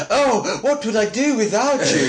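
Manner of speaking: high-pitched mock posh gushing; hammed-up posh gratitude; posh accent. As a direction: put on a high, swooning posh voice. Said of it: "always switches to his posh accent".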